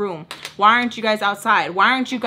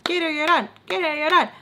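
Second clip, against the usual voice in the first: higher, sing-song taunt; Spanish taunt, higher and mocking; 7 semitones higher than usual. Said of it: "She says".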